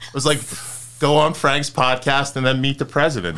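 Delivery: high and loud, giddy; excited. Real, not acted. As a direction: gush about it. Excited joking guest.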